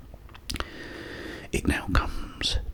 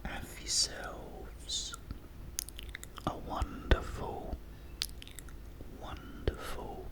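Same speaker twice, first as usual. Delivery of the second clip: fading whisper; 10 dB quieter, slower than usual. Real, not acted. Wishing viewers a peaceful day, fading whisper. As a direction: whisper sinking much softer, slow. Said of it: "repeats words fading to sleepiness".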